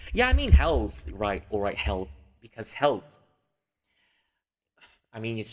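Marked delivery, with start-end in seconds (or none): 0.1-1.1 louder, higher self-correction; correcting herself. Real, not acted. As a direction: fix the word firmly: "health" not "bless". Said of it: "Bless health".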